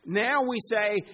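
usual speaking voice

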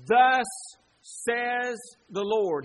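loud, slow, solemn proclamation; prophet's proclamation. Acted. Preacher voices Jeremiah addressing Jerusalem, proclaiming. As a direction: proclaim it like a prophet, each word weighty. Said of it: "here was the proclamation of the prophet".

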